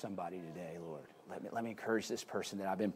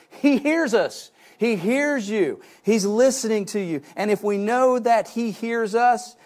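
full-force preaching; 8 semitones higher, 8 dB louder than usual. Acted. Preacher quoting First John to the congregation, preaching at full force. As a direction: proclaim it to the back row.

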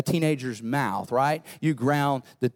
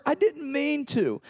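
mock excuse; 12 semitones higher than usual. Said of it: "we defend ourselves with more words".

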